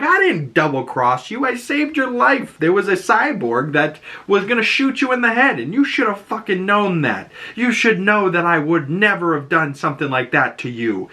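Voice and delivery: high, indignant mock comeback; retold angry outburst. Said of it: "they kind of blew up at each other".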